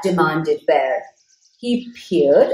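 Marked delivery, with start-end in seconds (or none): none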